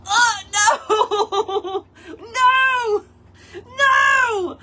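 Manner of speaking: shouting oh no with a laugh, pitch way up; mock panic, loud and high; panicked yell. Crafter yelling at her ruined flower, loud and high. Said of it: "I'm like".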